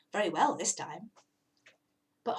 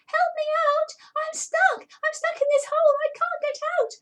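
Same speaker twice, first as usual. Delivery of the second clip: much higher, louder begging kitten; pleading cat; 7 dB louder than usual. Acted. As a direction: beg to be rescued. Trapped cat begging rabbit.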